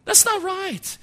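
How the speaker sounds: high-pitched, pained objection; re-enacted indignant plea; inner protest. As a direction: protest it high, drawing the words out. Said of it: "part of me that said".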